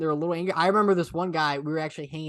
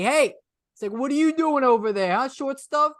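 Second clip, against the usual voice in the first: teasing heckle; 7 semitones higher than usual. Retold or imitated. Retelling a man heckling passersby outside a restaurant, teasing. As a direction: call out to needle passersby, imitating him. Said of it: "he's making fun of people".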